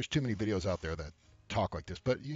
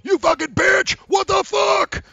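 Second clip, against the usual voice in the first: high-pitched screaming rant; butthurt outburst; 15 dB louder, much slower than usual. Acted. Ignored guy exploding at a girl, screaming furiously. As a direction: scream it, mock-enraged, voice shooting way up.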